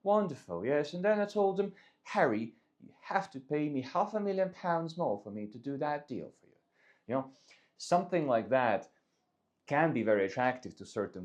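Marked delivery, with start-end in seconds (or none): 0.0-6.6 high, hammed-up upper-class English accent; plummy, mock-aristocratic English accent; posh English accent. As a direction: play a posh English gent showing off. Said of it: "posh English accent".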